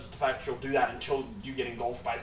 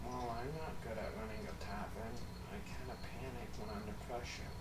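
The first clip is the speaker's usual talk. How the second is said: soft, low timid voice; meek daughter; 3 semitones lower, 10 dB quieter than usual. Acted. Daughter admits she panicked running the tavern, soft and timid. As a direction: mumble it like you're embarrassed. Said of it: "She looks and goes".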